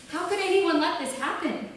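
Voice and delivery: loud, high cry of horror; anguished cry. A narrator's reading. Woman over the dead children, crying out in horror.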